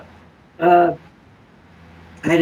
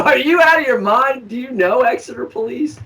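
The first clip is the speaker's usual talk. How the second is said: higher, louder disbelieving retort; indignant raised retort; incredulous retort; 8 semitones higher, 4 dB louder than usual. Retold or imitated. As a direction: scoff at him, raised and loud. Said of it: "I go".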